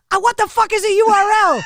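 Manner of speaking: high, loud imitation of a baffled user; mock exasperation. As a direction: blurt it out in comic bewilderment. Imitates confused Lenny's outburst.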